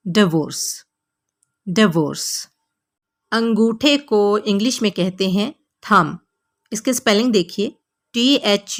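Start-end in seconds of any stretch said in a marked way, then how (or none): 0.1-2.5 डिवोर्स with a short "di"; डिवोर्स repeated clearly; correct pronunciation. Shown as a demonstration. Teacher drilling divorce. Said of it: "आपने बोलना है".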